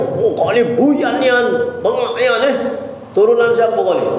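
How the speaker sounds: mock angry teacher scolding. Shown as a demonstration. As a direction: berate the pupil like an angry teacher. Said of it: "kalau aku marah macam itu".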